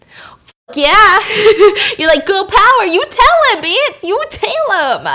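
put-on loud, high girl-power cheer with a laugh; mock hype-girl holler; hyped-up cheering. Cheering on the wife's bold line.

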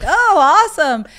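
woman faking delight to her fiancé over a Christmas gift; fake delight. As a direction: gush it brightly while not meaning it.

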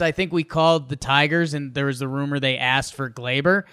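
ordinary talk

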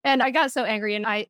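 normal talking voice

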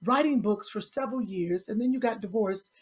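a hint of a host reading a long guest bio; imitating a talk show host. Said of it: "Who talks like that".

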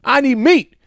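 high, loud indignant yell; mock outrage, shouting he wants meat; a man refusing a veggie sandwich, yelling high and loud; furious yelling man. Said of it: "And then goes".

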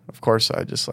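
ordinary delivery

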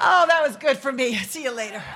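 normal talking voice

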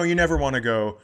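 usual speaking voice

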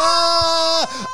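high loud shriek; mock Babadook scream, high and loud; movie scream.

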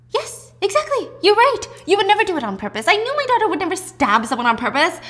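high, loud gush of agreement; over-eager mother. Retold or imitated. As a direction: reassure her too eagerly, voice high. Acted mother eagerly reassuring daughter.

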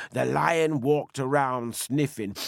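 ordinary delivery

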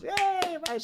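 a burst of hammed-up student enthusiasm; mock cheer. Students cheering the dean's welcome, a squeaky mock cheer.

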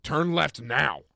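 slow, loud, high command; imitated drunken shouting; yelled order. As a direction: shout it word by word. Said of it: "What did he say?".

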